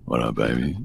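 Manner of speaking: ordinary talk, nothing marked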